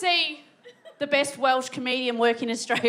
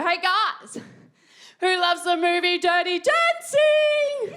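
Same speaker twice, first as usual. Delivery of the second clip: drawn-out, singsong crowd call; slow, rising MC holler; hyping the crowd; 2 semitones higher, 3 dB louder, much slower than usual. Retold or imitated. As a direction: work the audience up, stretching the last words. Retold hyping up the audience.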